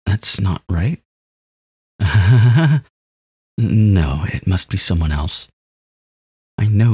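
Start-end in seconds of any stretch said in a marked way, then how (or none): 2.0-2.8 sudden burst of laughter; loud laugh. Real, not acted. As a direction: laugh out loud, a touch higher. Laughing at the name.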